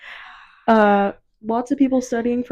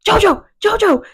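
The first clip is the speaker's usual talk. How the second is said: shouting paparazzi; 7 semitones higher, 8 dB louder than usual. Retold or imitated. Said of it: "And I was like".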